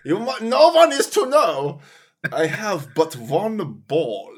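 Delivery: lightly voiced secretive Hitler; confiding like a hammy dictator. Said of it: "he was like".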